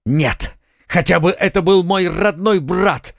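lifted, eager cry of delight; a young Gascon's theatrical outburst; rapturous exclaim. A narrator's reading. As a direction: burst out in mock rapture. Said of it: "вскричал Д'Артаньян как бы в порыве восторга".

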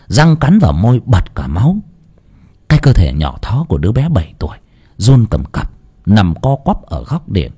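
usual speaking voice

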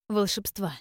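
usual speaking voice

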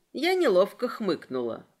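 ordinary delivery

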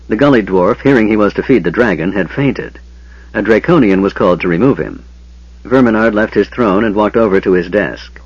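ordinary delivery, nothing marked

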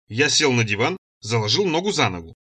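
plain reading voice

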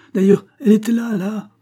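high, loud and agitated; frantic insistence. A narrator's reading. Farmer staring at the empty hiding place, frantic insistence. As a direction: insist urgently on the spot.

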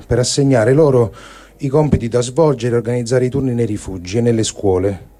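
usual speaking voice

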